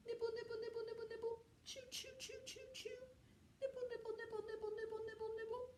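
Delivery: very high, soft squeaky chant; tiny mouse nibbling. Acted. Mouse chews the lion free, squeaky soft chanting. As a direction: squeak it out like a busy little mouse. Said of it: "and he went".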